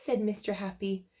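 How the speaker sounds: usual speaking voice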